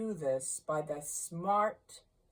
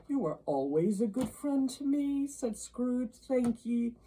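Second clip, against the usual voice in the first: put-on higher, wary old man; nervous thanks; 7 semitones higher, 4 dB louder than usual. A narrator's reading. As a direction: thank him uneasily.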